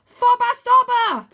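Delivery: high, loud, slow command shout. A narrator's reading. Arthur shouting to make Vlad dive. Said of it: "I shout".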